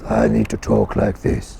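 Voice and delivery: pressed, slightly deep voice, drawn out; Connor's low throat voice. Retold or imitated. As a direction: press down on the throat and talk slowly. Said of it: "I've never done what Connor did".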